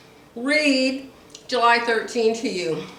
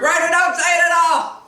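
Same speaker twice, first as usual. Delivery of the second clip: exasperated friend; 8 semitones higher, 6 dB louder than usual. Pretend. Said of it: "too sweet to tell me".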